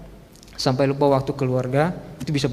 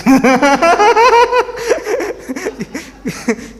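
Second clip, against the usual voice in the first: loud high laugh; 14 semitones higher, 6 dB louder than usual. Real, not acted. Big laugh after the joke.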